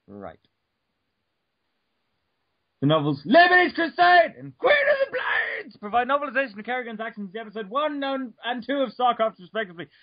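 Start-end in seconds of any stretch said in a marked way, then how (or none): 2.8-6.6 high, loud reading burst; dramatic shout. Real, not acted. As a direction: blast the title out theatrically.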